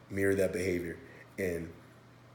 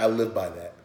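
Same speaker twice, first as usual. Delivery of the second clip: ordinary talk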